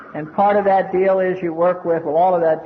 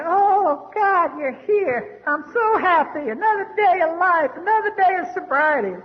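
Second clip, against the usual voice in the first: high, stretched-out joyful greeting; overjoyed; 9 semitones higher than usual. Retold or imitated. Dying man welcoming visitors, overjoyed and high-pitched. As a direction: imitate a sick man delighted by his visitors. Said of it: "All he talked about was how happy he was".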